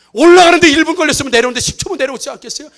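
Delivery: ordinary talk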